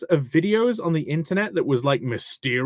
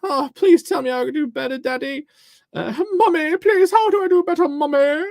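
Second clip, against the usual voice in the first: put-on whiny child; 12 semitones higher, 5 dB louder than usual. Babyish begging to parents.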